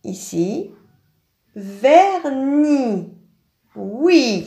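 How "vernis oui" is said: normal talking voice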